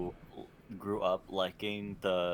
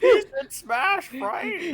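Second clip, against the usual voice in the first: surprised; 12 dB louder, slower than usual. Real, not acted. Excited disbelief about Sora.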